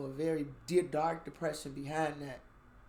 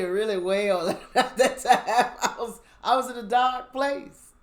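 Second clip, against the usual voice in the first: laughing through the words, louder and higher; 7 semitones higher, 11 dB louder than usual. Real, not acted. Laughing to listeners about her dark place. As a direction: giggle it out at your own darkness.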